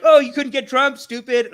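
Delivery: loud, high mimicry of a critic; mock taunt. Host imitating critics of Democrats, loud mocking taunt. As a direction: jeer it as an imagined armchair critic.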